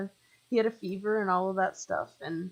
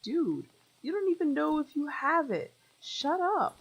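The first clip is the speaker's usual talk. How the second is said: high, exasperated brush-off; pitched way up, telling him to knock it off; exasperated scolding; 9 semitones higher than usual. Retold or imitated. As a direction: wave away his fuss, half laughing. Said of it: "And I'm like".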